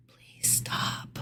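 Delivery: desperate whispered plea; pleading whisper. Retold or imitated. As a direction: whisper it like a begging survivor. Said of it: "wouldn't you be like".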